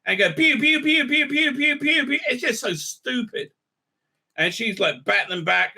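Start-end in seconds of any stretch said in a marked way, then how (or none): 0.3-2.2 high rapid-fire pew noises; imitated blaster fire. Showing the other speeder shooting, high mock blaster noises. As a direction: mimic a string of laser shots, high-pitched.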